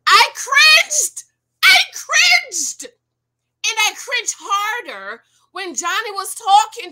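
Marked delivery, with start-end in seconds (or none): 0.1-5.2 high, loud, grossed out; disgusted cringe. Real, not acted. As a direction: squeal your disgust. Reacting to the kissing clip.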